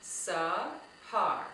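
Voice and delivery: "Sahar" slowly split into two syllables. Shown as a demonstration. Teacher showing children the syllables in her name, "Sahar" said very slowly. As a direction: say "Sahar" in two separate, drawn-out beats. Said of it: "say my name very slowly".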